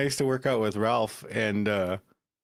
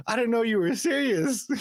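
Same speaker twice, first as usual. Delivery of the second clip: high mock quote; 12 semitones higher than usual. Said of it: "He's like".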